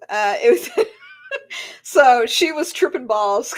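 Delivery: normal talking voice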